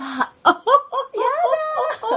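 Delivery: high, drawn-out laughing auntie squeal. Pretend. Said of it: "あれ、おばちゃんになっちゃった".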